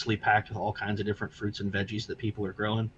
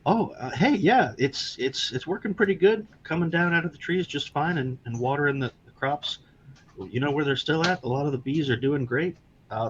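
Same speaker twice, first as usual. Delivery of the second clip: high, friendly Midwestern farmer; cheerful small-town farmer voice; Midwestern accent; 5 semitones higher than usual. Acted. Farmer answers in put-on voice.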